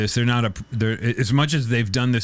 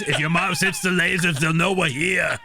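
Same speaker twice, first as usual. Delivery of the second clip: ordinary delivery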